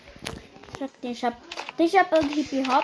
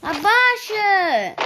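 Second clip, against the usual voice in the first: loud, slow, stretched yell; drawn-out shout; 9 semitones higher, 7 dB louder, much slower than usual. Real, not acted. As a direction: drag out the order loudly. Yelling to lower volume.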